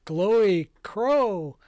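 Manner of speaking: slow, loud dazed wonder; mesmerized, stretched-out words; entranced guard. Acted. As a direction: gape at it in drawn-out awe. Voicing a guard entranced by the crow.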